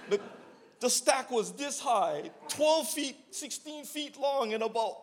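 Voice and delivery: high, excited, laughing a little; amazed. Retold or imitated. Describing the huge brick stack, high amazed. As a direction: paint the pile as absurd, pitch up.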